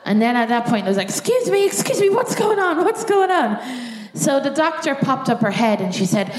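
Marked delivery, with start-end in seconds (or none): 1.1-3.6 high, alarmed pleading; high-pitched startled demand for answers. Retold or imitated. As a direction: call out in sudden panic. Panicked patient asking the doctor.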